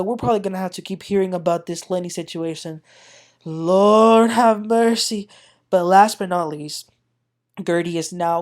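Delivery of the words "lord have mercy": very slow, drawn-out weary plea; exasperated. Real, not acted. Recapper groaning about the Lenny situation, slow and weary. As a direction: stretch it like you're fed up.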